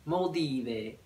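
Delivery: "Moldive" sounded out as mol-DEE-vay; alternative pronunciation. Real, not acted. Vlogger testing a shop name, alternative pronunciation "mol-dee-vay". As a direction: try "Moldive" softly with an ending like "ee-vay".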